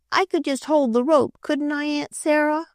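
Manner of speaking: put-on high, eager little girl's plea; pleading little girl. A narrator's reading. Little girl begging Aunt Sarah, high and eager. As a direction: beg like a small girl who wants a turn.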